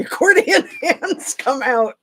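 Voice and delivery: high-pitched, laughing through the words; amused giggle breaking into the phrase. Real, not acted. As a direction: crack up while saying it. Cracks up describing the hands.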